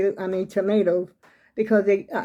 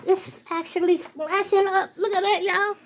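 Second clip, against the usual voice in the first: panicky, rising alarm at the splashing; real panic as the leak splashes up; 10 semitones higher than usual. Real, not acted. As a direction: voice shooting high in sudden alarm. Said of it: "I'm panicking".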